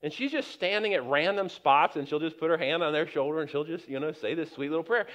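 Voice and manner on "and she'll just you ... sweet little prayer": ordinary talk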